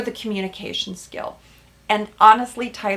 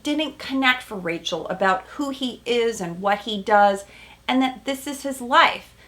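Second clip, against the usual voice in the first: ordinary talk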